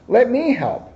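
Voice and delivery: eager little offer, up high; high giraffe voice. Acted. Giraffe voiced in picture book.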